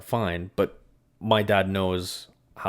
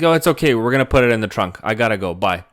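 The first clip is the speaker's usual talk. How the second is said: high, louder breezy dad voice; imitating dad; 3 semitones higher, 8 dB louder than usual. Son mimicking dad brushing off the coffee worry, loud and breezy. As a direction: toss it off like a careless dad. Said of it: "He's like".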